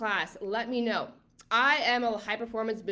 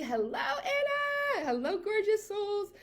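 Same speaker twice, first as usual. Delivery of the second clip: very high, slow, sing-song hello; gushing greeting; 11 semitones higher, slower than usual. Real, not acted. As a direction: stretch each hello up high with delight. Greeting viewers by name.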